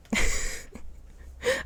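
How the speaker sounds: high, breathy laugh at herself; embarrassed laugh. Real, not acted. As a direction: laugh off the failed try. Laughing at own failed accent.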